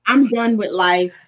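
ordinary talk, nothing marked

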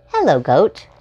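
ordinary delivery

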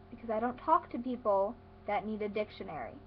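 normal talking voice

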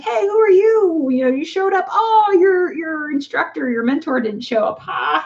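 high, mocking singsong ribbing; teasing. Shooter ribbing him that his mentor never came, high mocking tease. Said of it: "and teasing me".

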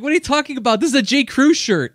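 high, loud and offended; indignant high voice. Pretend. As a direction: squeak it out like a miffed shopper. Voicing an indignant human to robots.

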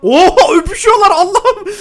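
shrieking laughter. Real, not acted. Shocked Sims couple kissing after murder.

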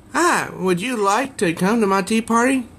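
put-on high sweet little-girl invite. Possessed girl inviting them to tea, high sweet child voice. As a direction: lift it up like a cheery six-year-old.

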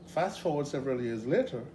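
normal talking voice

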